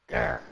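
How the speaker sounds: low, playful frustrated growl; low mock growl. Real, not acted. Streamer growling at the game over a useless reward, mock growl. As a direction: growl at the game in fake annoyance.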